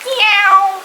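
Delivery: high, long mocking meow; cat meow. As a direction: stretch out a cat's meow. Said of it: "You just don't wanna talk".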